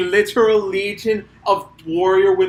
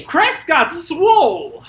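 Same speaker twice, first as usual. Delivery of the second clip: slow, high, louder exclaim; excited; 9 semitones higher, 4 dB louder, much slower than usual. Real, not acted. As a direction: drag it out in awe. Excited reaction.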